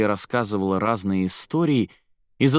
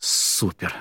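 usual speaking voice

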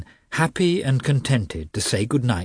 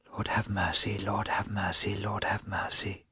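soft whispered plea, over and over; whispered prayer; 10 dB quieter than usual. A narrator's reading. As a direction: breathe it as a prayer to yourself. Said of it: "she repeated to herself".

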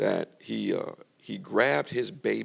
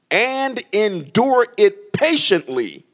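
higher, slow stretched phrase; drawn out emphasis; 11 semitones higher, 8 dB louder, much slower than usual. Acted. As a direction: stretch out endure it patiently. Preaching emphasis.

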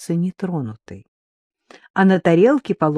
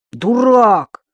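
angry shout; 5 semitones higher, 5 dB louder than usual. Acted. Girl scolding boy with slap.

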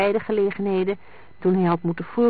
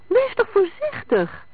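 very high, alarmed warning; anxious cry; 4 dB louder than usual. A narrator's reading. Ina warning the driver as the car swerves, frightened. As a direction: call out in sudden fright. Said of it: "riep Ina angstig".

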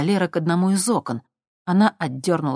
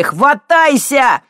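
loud, high, drawn-out call; urgent shout; 10 semitones higher, 10 dB louder, much slower than usual. Acted. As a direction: shout it reaching out a hand. Pulling her onto the rocks. (отчаянный крик на бегу)

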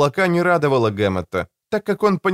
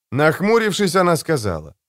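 ordinary delivery, nothing marked

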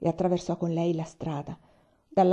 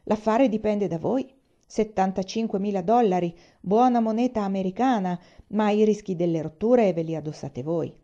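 ordinary delivery

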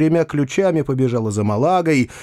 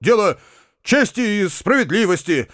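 higher-pitched, earnest insistence; firm insistence; 7 semitones higher than usual. A narrator's reading. Uncle demands Foma apologise to the girl, firmly. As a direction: press the demand, pausing between phrases. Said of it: "с твёрдостью отвечал дядя".

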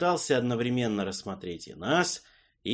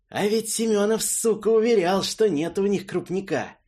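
hateful; 11 semitones higher, 6 dB louder than usual. Acted. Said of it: "с ненавистью припомнил".

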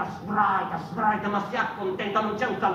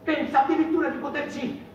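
rising mocking outburst; 7 semitones higher, 4 dB louder than usual.

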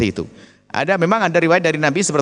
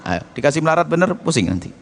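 ordinary delivery

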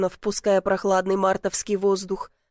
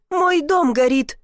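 higher, slower cry of dismay; alarmed cry; 5 semitones higher, 4 dB louder, slower than usual. A narrator's reading. Woman seeing her own house burn, alarmed. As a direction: stretch it out in rising alarm.

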